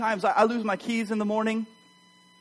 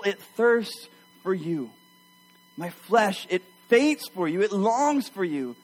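fervent psalm reading. Acted. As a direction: pray the psalm to God with longing. Reads psalm as prayer.

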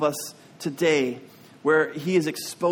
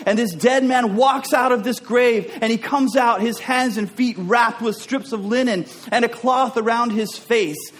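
high, loud, driving sermon delivery; full-force preaching; 6 semitones higher, 4 dB louder than usual. Acted. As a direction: preach it out as God's bold declaration, voice raised.

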